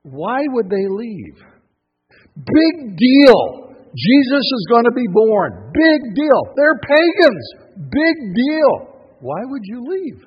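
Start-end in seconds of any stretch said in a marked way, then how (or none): 2.3-8.7 loud scoffing big deal; mock dismissive. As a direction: brush it off loudly, scornful. Mocking kings' dismissive reply.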